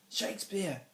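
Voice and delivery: high, sharp exclamation; snapping. A narrator's reading. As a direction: snap the name out to torment the teacher. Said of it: "Alvis snapped".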